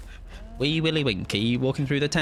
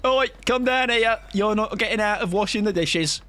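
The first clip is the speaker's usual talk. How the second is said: scolding mum calling; 7 semitones higher than usual. Retold or imitated. Imitating a mum calling her kid downstairs, mock scolding. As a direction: call out like a mum scolding a child. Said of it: "like a mum calling you".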